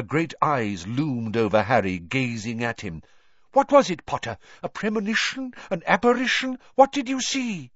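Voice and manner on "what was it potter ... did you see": high eager mystic voice; excited. A narrator's reading. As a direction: press him breathlessly for visions. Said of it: "looking thoroughly excited".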